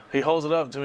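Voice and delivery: ordinary delivery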